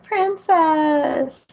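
high, drawn-out little creature call; hammed-up delighted call to a princess; little lizard calling. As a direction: sing it out high and slow.

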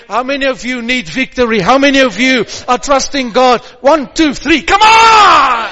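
loud fervent preaching; preaching at full force. Real, not acted. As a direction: build to a roaring call.

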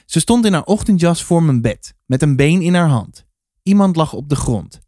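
usual speaking voice

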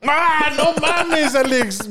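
high, slow drawn-out cry of disgust; shocked outcry. Real, not acted. Friend recoils from a gross video, shocked high outcry.

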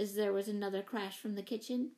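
ordinary delivery

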